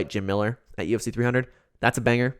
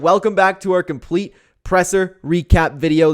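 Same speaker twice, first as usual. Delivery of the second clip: louder, slower showman welcome; hyped intro; 5 semitones higher, 6 dB louder, slower than usual. Real, not acted. Vlogger hyping his new recap series, loud and slow. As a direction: sell the new series to the viewers.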